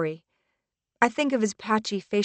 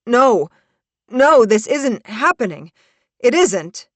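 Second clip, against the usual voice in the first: loud, higher, panicked denial; sickened; 5 semitones higher, 9 dB louder than usual. Acted. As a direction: deny it as if sick with horror. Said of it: "sounding sick".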